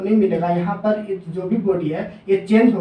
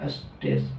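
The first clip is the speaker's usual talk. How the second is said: whispered answer; 10 dB quieter than usual. Real, not acted. Teacher answers own question.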